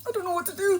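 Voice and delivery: mock helpless whimper; small scared voice. Panicking about dying.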